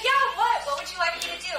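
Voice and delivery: high-pitched, over-keen reply; acted-out eagerness to help; eager agreement. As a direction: ask it brightly, starting high and loud. Repeats own eager reply.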